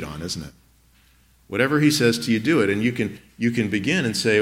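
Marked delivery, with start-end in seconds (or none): none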